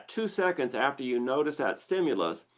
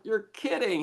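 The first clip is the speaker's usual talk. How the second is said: surprised disbelief; 9 semitones higher than usual. Retold or imitated. Acting out own surprised reply.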